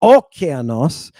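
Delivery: "okeanos" with a long, stressed opening "o"; stress on first syllable. Shown as a demonstration. Teacher showing how not to say it, first syllable stressed. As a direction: lean wrongly on the "o" of "okeanos". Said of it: "It's not".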